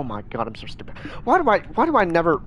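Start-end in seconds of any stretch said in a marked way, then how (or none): 0.0-1.0 whispered self-reproach under the breath. Real, not acted. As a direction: mutter it to yourself in a whisper. Muttering at own mistake.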